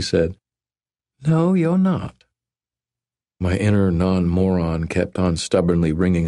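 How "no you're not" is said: put-on higher, playful young woman. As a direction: tease him like a Southern belle.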